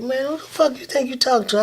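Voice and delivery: a touch of a hostile dealer; street tough retort. Retold or imitated. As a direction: brush off the preacher with attitude. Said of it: "the brother was like".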